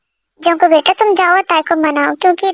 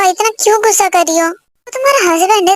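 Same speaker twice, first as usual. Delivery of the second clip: ordinary talk, nothing marked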